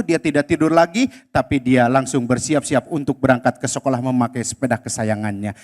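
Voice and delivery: low, soft storytelling voice; story narration. A narrator's reading. Storyteller telling children about Abdi, soft narration. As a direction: narrate gently to the kids.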